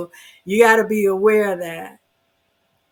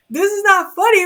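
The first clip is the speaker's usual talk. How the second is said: laughing despite herself; 9 semitones higher, 7 dB louder, faster than usual. Real, not acted. Laughs at her own point.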